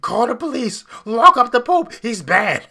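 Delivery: high, very loud hammed-up outrage; mock Karen yelling. Host parodying the archbishop as a complete Karen, shrill mock shouting. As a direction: shout it like an entitled complainer demanding police. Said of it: "acting like a complete Karen".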